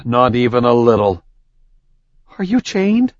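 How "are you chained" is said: frightened. Acted. Frightened heroine in darkness.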